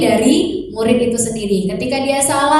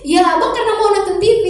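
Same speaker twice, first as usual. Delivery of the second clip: put-on higher little boy answering; child's voice; 8 semitones higher, much faster than usual. Son answers mother, higher child voice. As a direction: play the son explaining himself.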